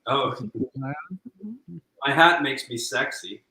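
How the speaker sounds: ordinary delivery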